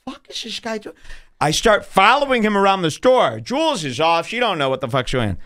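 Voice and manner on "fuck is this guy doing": stunned disbelief. Retold or imitated. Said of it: "and I go".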